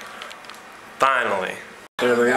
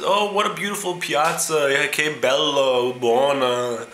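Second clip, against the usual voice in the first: higher, gushing tourist voice; gushing American tourist; 4 semitones higher, slower than usual. Retold or imitated. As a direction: gush over the piazza, drawn out. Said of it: "the tourist says".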